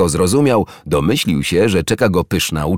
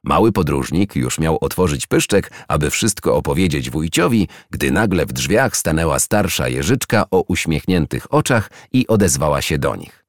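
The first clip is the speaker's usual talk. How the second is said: usual speaking voice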